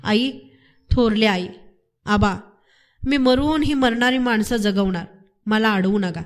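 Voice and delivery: ordinary delivery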